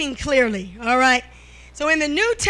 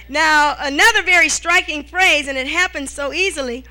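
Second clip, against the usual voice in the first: ordinary talk, nothing marked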